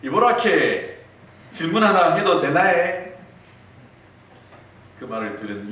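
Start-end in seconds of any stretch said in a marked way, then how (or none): 0.0-3.1 high, loud Gyeongsang dialect with playful charm; 애교 부리는 경상도 사투리; Gyeongsang wife being cute. Retold or imitated. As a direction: coax her husband in thick Gyeongsang dialect. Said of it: "애교스러운 이 표정을 지으면서".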